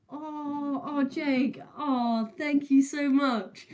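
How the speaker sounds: high, teary thanks with a catch in the voice; overwhelmed by a gift, close to tears; tearful thanks; choked up. Real, not acted. Donation from Jake, moved.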